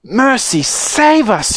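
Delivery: high-pitched cry for mercy; panicked yell. Acted. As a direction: wail out in motherly panic. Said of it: "yelled Missus Beauregard".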